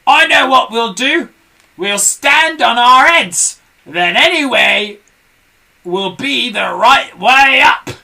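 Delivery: high, loud, slow eager plan; Mr Twit excited. A narrator's reading. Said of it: "cried Mister Twit".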